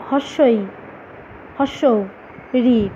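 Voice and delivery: হর্ষই, হর্ষ, রি each said briefly, with gaps; short vowels. Shown as a demonstration. Teacher demonstrating short vowels to students, each said briefly. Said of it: "কম সময় লাগছে".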